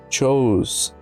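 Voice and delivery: usual speaking voice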